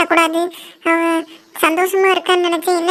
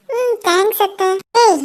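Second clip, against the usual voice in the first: usual speaking voice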